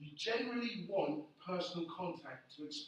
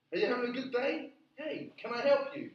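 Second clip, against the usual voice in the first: higher, louder, chirpy salesman pitch; eager shop assistant; 6 semitones higher, 5 dB louder than usual. Retold or imitated. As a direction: mimic a keen young sales assistant buttering you up. Apple shop child chatting up customer.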